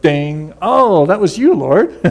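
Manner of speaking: the light finally comes on; delighted mock surprise; sudden realization. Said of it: "it's like".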